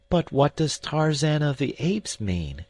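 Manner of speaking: put-on young lady pleading for an answer; girl crying out. A narrator's reading. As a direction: ask it anxiously. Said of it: "cried the girl".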